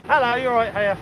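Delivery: cheery greeting. Real, not acted. Greeting passer-by.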